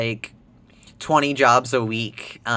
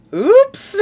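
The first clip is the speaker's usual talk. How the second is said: very high, loud squeaked exclamation; amused surprise; 11 dB louder than usual. Real, not acted. Streamer reacting to the game, amused surprise. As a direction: yelp it, about to burst out laughing. Mishap in the game, laughing after.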